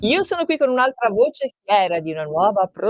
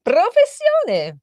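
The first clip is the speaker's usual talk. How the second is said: playful quiz question; 10 semitones higher, 3 dB louder, much slower than usual. Acted. Host reads an interview question.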